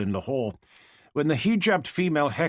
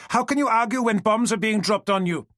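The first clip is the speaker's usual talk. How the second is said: heated outburst; 11 semitones higher, 5 dB louder than usual. Acted. Heckler shouts at Steele.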